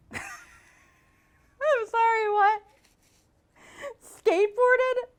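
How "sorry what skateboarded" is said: stunned disbelief. Real, not acted. Shocked by the ad text.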